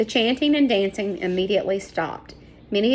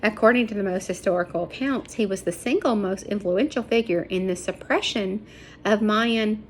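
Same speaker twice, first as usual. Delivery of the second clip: plain reading voice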